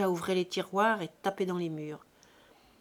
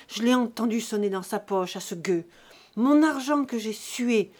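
loud, insistent accusation; heated, hammering insistence; angry demanding; 7 dB louder than usual. A narrator's reading. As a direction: whip up your anger as you demand. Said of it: "cette phrase dont il fouettait sa colère".

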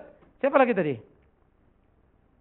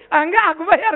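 loud mock insistence; insisting loudly; 8 semitones higher, 11 dB louder than usual. Paying for the enemy's meal, insisting loudly. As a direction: argue loudly over the bill.

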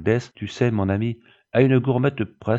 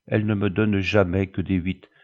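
usual speaking voice

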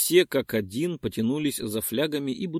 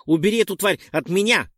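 higher, louder frightened yell; yelling in fright; 7 semitones higher, 6 dB louder than usual. Acted. Dex yells at Perk to take the bug away, frightened yell. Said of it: "завопил Декс".

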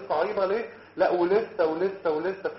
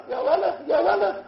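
mock yell; 10 semitones higher, 5 dB louder, slower than usual. Mocking a student in class.

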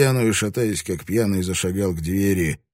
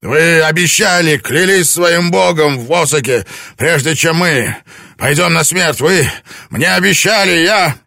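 loud, much higher strained demand; desperate insisting; 10 semitones higher, 10 dB louder than usual. A narrator's reading. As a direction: force out each word in fury. Lord insisting, barely controlled.

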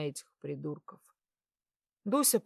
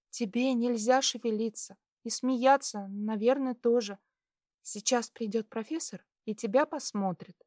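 high, light female voice, gently cautioning; concerned girl; 7 semitones higher than usual. A narrator's reading. Girl telling patient to keep still, concerned. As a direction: caution him in a higher girlish voice.

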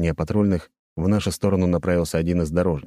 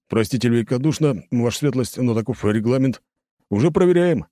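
higher, apologetic officer's voice; trying to placate; 6 semitones higher than usual. A narrator's reading. Lieutenant placating an irritated prince, higher-pitched. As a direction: soothe a superior while citing the rules. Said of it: "попытался успокоить меня Киреев".

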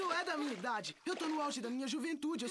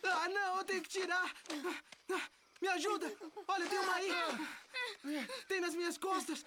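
higher, frantic screams; panicked; 4 semitones higher than usual. Acted. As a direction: panic over the leeches. Leeches found, panic.

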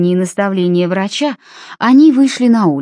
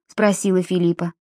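ordinary delivery, nothing marked

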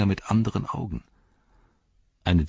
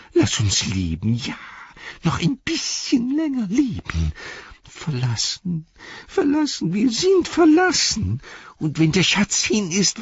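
very high, drawn-out creature wail; Gollum; slower than usual. A narrator's reading. Gollum lies flat begging for life, wailing. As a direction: whine and plead in a wretched screech. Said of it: "jammerte er".

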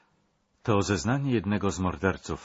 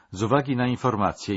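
brisk, raised official proclamation; formal decree reading; 5 semitones higher, 5 dB louder, faster than usual. Acted. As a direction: read the sentence out fast like an order. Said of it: "zaczął odczytywać na głos rozkaz". (szybkie, oficjalne odczytanie rozkazu)

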